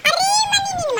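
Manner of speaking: high, loud sudden call to mommy; excited shout. Real, not acted. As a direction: blurt it out as it hits you. Suddenly remembers, calls mother.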